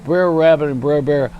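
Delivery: ordinary talk, nothing marked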